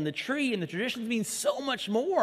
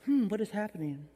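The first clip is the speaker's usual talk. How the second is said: calm puzzled; 4 dB quieter than usual. Retold or imitated. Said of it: "this picture of Mary's like".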